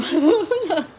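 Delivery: breathless giggle; joyful laugh. Real, not acted. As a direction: laugh after the whoop. Laughing after cheering.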